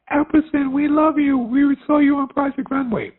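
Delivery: high, loud scream out a car window; imitated fan's scream. Lady screams from a car to a designer on the corner, imitated scream. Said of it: "this lady screams out the window".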